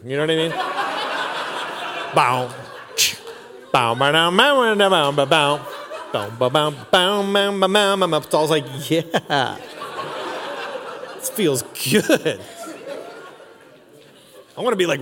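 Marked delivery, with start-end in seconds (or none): 2.1-8.2 vocal guitar riff. Retold or imitated. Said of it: "imitating guitar riff sound". 8.9-12.4 slow, laughing "this feels good"; mock blissful Saul. Saul soothed by the harp, slow and laughing.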